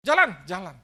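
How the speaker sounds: loud, insistent urging; urging call. Retold or imitated. Young man urging his healed father to walk, loud urging. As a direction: call him forward step by step.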